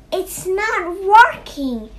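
high, loud, slow crow shout; frustrated shout. A narrator's reading. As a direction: shout it out slowly as the struggling crow. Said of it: "shouted Crow".

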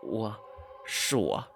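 a soft, embarrassed reply; small voice. A narrator's reading. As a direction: answer sheepishly and softly. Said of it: "他小声说". (不好意思地小声说)

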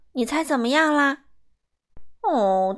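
usual speaking voice

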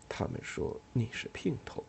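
whispered across the table. Acted. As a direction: breathe it so no one hears. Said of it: "低声讲起来".

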